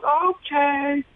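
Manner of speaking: high shrieked mock crowd call; crowd catchphrase yell. Fan shouting a Chappelle catchphrase, high mock yell. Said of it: "And somebody else yells".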